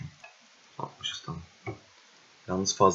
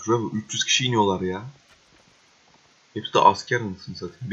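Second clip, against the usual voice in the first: normal talking voice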